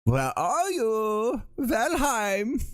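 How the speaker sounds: put-on high, slow, drawn-out German-accented call; hammed-up German search call, rising at the end; movie German accent. Streamer calls out for Valheim, hammed-up German accent. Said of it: "movie version of a, of a German accent".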